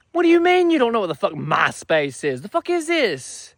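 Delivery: hammed-up disbelief, cracking up high at the end; exasperated rant. Ranting at the clueless teen.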